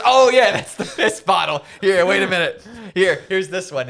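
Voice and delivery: high loud cartoonish Frank; hammed-up Frank impression. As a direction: bark it out high and careless. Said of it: "And he goes".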